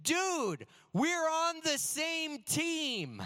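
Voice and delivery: put-on high, slow, shocked plea from a hurt kid; stunned teammate. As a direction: say it like you can't believe he hit you. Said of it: "He said this".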